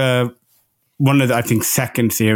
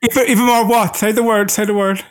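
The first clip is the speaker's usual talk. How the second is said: teasing push; 9 semitones higher, faster than usual. Real, not acted. Pushing him to say it.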